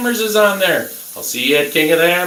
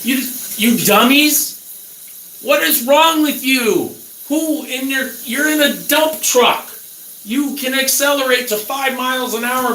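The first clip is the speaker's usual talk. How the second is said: exasperated rant; 6 semitones higher than usual. Real, not acted. Ranting at the dead driver.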